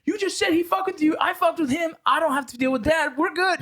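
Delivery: high, breezy retort; high mock reply. Brother answers his sister, high breezy retort.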